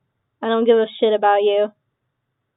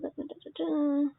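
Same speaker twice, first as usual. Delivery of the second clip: mumbled filler; 4 semitones higher, 12 dB quieter, faster than usual. Real, not acted. Filling in report form.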